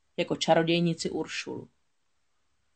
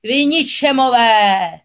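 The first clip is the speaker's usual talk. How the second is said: slow, loud, high furious curse; railing; 4 semitones higher, 9 dB louder, much slower than usual. A narrator's reading. Giant witch cursing Erik and Ariel, slow and furious. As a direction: spit it out in rage. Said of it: "spílala".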